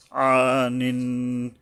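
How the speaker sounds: "আনিন" chanted very slowly, landing on a held n; joining the noons. Shown as a demonstration. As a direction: stretch the first vowel of "আনিন" and hum into the final n. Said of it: "নুন গিয়া নুন ধরবে".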